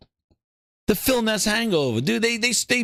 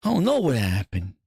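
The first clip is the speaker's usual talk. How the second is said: groggy low voice. Acted. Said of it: "It's like".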